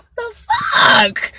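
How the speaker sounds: high, slow, cried-out disbelief; a stunned yelp that stretches the words; shocked outburst. Retold or imitated. Telling friends how stunned she was, crying out high. Shocked inner reaction to question.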